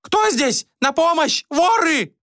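very high-pitched female cry for help; frightened women's voices. A narrator's reading. Women in the bedrooms raise the alarm, high panicked cries. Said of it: "донеслись женские голоса".

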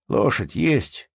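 "есть" drawn out and rising at the end; questioning. A narrator's reading. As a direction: ask slowly, lifting the end of "есть". Innkeeper asks about the horse.

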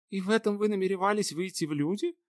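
high, incredulous princess voice; mocking. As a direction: question him with disbelief. Anya mocking the outfit.

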